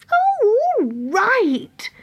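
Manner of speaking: very high, slow, reluctant fairy groan; slow high grudging sigh of a reply; grudgingly. Acted. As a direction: drag it out like tired fairies giving in. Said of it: "said the fairies grudgingly".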